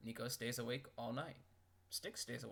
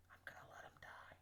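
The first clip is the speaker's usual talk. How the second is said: whisper; 13 dB quieter than usual. Acted. Menacing aside about letting him die.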